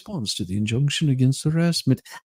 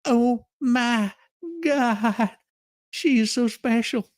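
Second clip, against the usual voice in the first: sarcastic awe; 8 semitones higher, slower than usual. Real, not acted. Sarcastic reaction to the claim.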